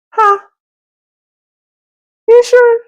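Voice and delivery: high, squeaky "You sure?"; disbelieving high squeak; surprised. Retold or imitated. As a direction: act amazed at the gift. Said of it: "retold surprise at gift".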